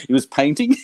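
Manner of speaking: ordinary talk, nothing marked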